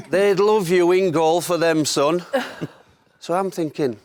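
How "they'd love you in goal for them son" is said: high, slow mock Clough accent, laughing at the end. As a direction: tease the young keeper, stretching each word. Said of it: "He's going".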